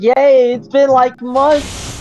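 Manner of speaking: retold excitement. Retelling how the Jedi took promotion, excited. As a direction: play a thrilled crowd cheering. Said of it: "they were all excited".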